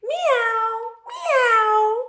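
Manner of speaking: very high, loud meowing; cat meow. A narrator's reading. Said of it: "cried the cat".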